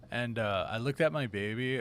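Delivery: ordinary talk, nothing marked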